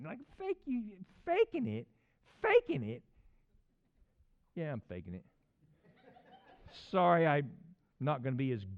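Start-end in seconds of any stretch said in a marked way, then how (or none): 0.4-3.0 high, incredulous squeaky question; hammed-up offended falsetto; mock disbelief. Acts disbelief at being called fake. 4.5-5.2 deadpan. Acted. Drops into dry answer to accusation.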